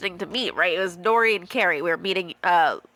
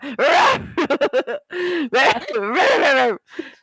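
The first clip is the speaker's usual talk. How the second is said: high screeching chirps; imitated baby bird squawks; 6 semitones higher, 4 dB louder than usual. Imitating orphaned baby birds wanting food, shrieking squawks. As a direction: screech like hungry nestlings. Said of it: "that's the noise that they made".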